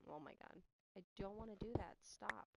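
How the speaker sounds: normal talking voice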